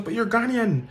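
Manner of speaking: imitating a woman's high pitched protest; amused objection. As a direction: tell him he's obviously Ghanaian.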